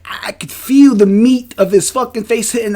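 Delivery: ordinary talk, nothing marked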